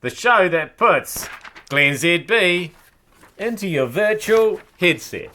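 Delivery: upbeat slow announcer welcome; show intro. Acted. Host welcoming viewers to his show, announcer style.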